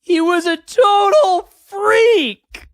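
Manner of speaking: sobbing high wail of disbelief; loud tearful cry, drawn out slow; fake-sobbing shout of despair. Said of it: "it sounded like he was crying".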